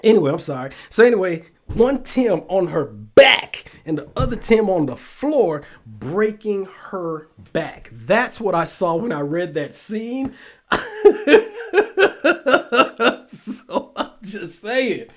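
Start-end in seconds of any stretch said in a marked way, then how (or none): 1.7-3.7 "back" hit hard, then a beat; stress on BACK. Real, not acted. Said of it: "why do I keep emphasizing the word back". 10.7-15.1 high, loud burst of laughter into slow words; helpless laughter at your own joke; cracking up. Real, not acted. Cracking up at own vivid scene.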